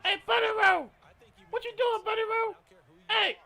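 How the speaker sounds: high, loud calling voice imitation; imitating a caller. Someone calling the narrator over at work, high loud shouting imitation. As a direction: holler across the yard like the boss calling him over. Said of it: "And then we hear that voice".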